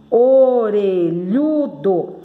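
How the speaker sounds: orelhudo stretched very slowly as o-re-lhu-do; syllable by syllable. Shown as a demonstration. Teacher showing how words separate, orelhudo in long drawn syllables. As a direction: draw out every syllable of Orelhudo, loud and clear. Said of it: "Tô falando separando".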